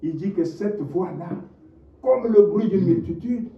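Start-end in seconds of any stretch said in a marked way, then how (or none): 2.0-3.5 high, loud testimony with fervor; impassioned preaching. Acted. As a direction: proclaim it to the whole church. Preaching on the meaning of the voice. (témoignage prêché avec ferveur, voix haute et forte)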